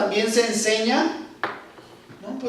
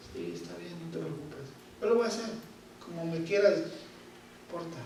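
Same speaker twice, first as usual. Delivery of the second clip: soft, gentle and much quieter; meek soft reply; 4 semitones lower, 10 dB quieter than usual. Retold or imitated. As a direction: answer patiently, barely above a murmur. Respuesta paciente y sumisa. (respuesta suave y sumisa)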